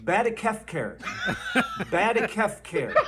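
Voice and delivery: "Badakefcare" said carefully through a laugh; laughing teacher sounding out "Badakefcare"; mock Bidenese word. Shown as a demonstration. Teacher drilling the audience, amused careful teaching. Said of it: "the next word we've got is".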